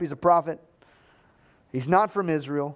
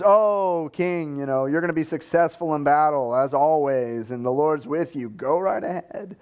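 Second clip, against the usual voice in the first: sarcastic. Retold or imitated. Jailed prophet humoring the king, sarcastically. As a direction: play up the hollow encouragement. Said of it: "he's sarcastic".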